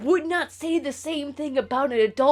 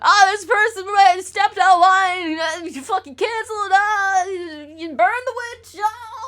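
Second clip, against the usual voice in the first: high, loud mocking mob voice; mocking angry mob; 9 semitones higher, 6 dB louder than usual. Streamer imitating an online mob calling to cancel someone, high and loud. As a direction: mimic a frenzied online mob piling on. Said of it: "a mob effect of being like".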